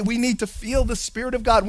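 normal talking voice

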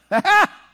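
sudden high loud "ha ha" burst; mock holy laugh. Imitating the lady who laughed in church. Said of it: "she would go".

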